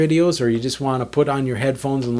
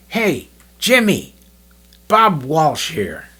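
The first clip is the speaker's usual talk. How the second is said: slow, higher Bob voice introducing himself; Bob on the phone; 4 semitones higher, much slower than usual. Retold or imitated. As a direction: put on Bob's slow, self-important phone greeting. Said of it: "he calls me up and he said".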